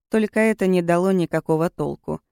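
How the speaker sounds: ordinary delivery, nothing marked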